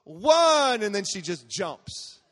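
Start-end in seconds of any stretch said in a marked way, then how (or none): 0.0-0.8 high, very loud drawn-out count; gleeful-kid yell, stretched out; child's countdown shout. Retold or imitated. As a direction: imitate a little girl belting the last number. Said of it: "And she goes".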